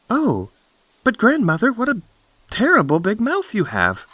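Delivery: put-on very high, agitated little girl; alarmed Little Red Cap. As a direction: exclaim in rising alarm, pitched high.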